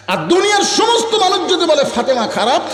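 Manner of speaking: high-pitched, forceful sermon delivery; impassioned preaching. Acted. Voicing Fatema's plea to her husband, impassioned preaching. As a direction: drive the plea home from up high.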